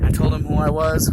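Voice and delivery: usual speaking voice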